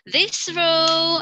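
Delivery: slow, high, loud address; teacher addressing rows. Shown as a demonstration. Teacher assigning a row of students, slow and loud. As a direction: point at the row and call it. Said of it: "classroom instructions demo".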